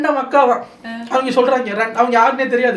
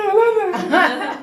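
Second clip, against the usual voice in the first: high, drawn-out, exaggerated shushing of a crying girl; mock soothing; 10 semitones higher, much slower than usual. Housemate mockingly comforting a fake-crying girl, high and slow. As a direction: stretch each word like comforting a baby, half laughing. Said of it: "இவங்கள சாண்ட்ராலாம்".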